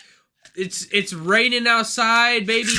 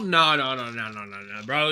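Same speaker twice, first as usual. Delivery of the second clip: the no's drop lower and much softer; fading no's; 12 semitones lower, 6 dB quieter than usual. Real, not acted. Brushing off the theory, fading to near silence. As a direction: trail off into a quiet "no, no, bro".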